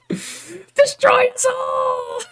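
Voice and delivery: very high, very slow drawn-out villain cry; cartoon villain scream. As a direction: stretch out the doom cry in a cartoon voice. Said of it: "screaming his head off".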